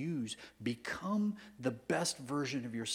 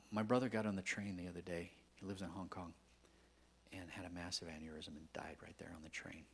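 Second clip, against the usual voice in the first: usual speaking voice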